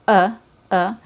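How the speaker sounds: short neutral uh, mouth barely open; schwa sound demo. Shown as a demonstration. Teacher repeating the schwa. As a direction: push out a weak uh. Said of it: "phonetically spelled with U H".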